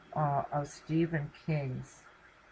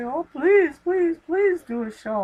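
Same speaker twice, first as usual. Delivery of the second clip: mock pleading caller; 10 semitones higher, 7 dB louder than usual.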